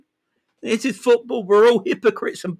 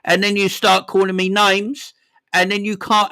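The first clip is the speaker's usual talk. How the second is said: normal talking voice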